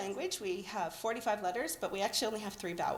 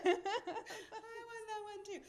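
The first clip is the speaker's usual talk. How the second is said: laughing; 13 semitones higher, 8 dB quieter than usual. Real, not acted. Laughing, out of breath after singing.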